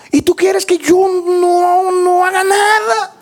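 loud-pitched, drawn-out incredulous protest; indignant protest. Acted. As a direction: protest in a high, insistent preaching cry. Acted indignant protest at the command. (protesta indignada, aguda y alargada)

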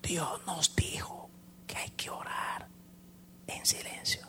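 hushed whisper, reminding her; whispered reminder to keep silent. Retold or imitated. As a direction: whisper it as if in church prayer. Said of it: "Y le dije".

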